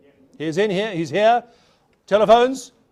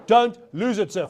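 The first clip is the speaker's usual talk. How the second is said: normal talking voice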